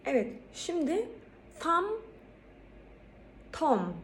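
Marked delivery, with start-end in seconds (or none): 1.6-1.9 "thumb" said high, b silent; pronunciation demo. Shown as a demonstration. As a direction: model "thumb". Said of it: "Bu iki fiile geldik". ("thumb" yüksek tonla)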